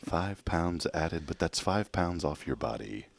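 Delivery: very slow, soft guide voice; mock calm meditation; soft spa whisper. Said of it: "one of those meditation episodes".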